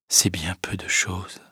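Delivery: soft, breathless whisper to herself; whispered thought. Acted. Emma thinking of death alone, whispered. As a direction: let the thought slip out as a whisper. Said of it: "pensa-t-elle".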